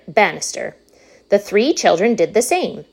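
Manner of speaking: ordinary delivery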